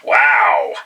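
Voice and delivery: ordinary talk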